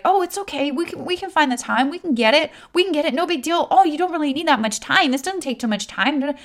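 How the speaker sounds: mock a pushy newbie brushing off the objection; eager amateur marketer. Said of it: "an amateur network marketer will try to be like".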